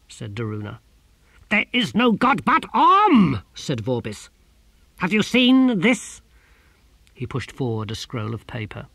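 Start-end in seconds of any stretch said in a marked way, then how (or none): 1.5-6.2 higher, louder, slow and absolute; stern declaration. A narrator's reading. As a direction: brook no argument. Vorbis asserts.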